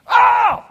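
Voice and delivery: sudden loud high "ow"; acted pained shout; yelp of pain. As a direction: yell out like you just hit your thumb. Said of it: "I hit my thumb".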